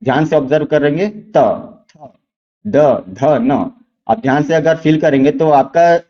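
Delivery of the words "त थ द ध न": त, थ, द, ध, न sounded out slowly so students feel the tongue; teacher demonstrating where the tongue touches for त थ द ध न; letters one by one. Shown as a demonstration. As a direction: let each of त थ द ध न ring alone. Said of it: "अभी तुरंत आप इसका उच्चारण कीजिए".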